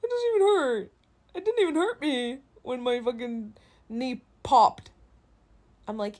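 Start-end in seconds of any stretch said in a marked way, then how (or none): 0.0-4.9 high, loud, scoffing imitation; a hammed-up skeptic waving it off; mock dismissive. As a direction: mimic someone brushing off the pain. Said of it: "And people go".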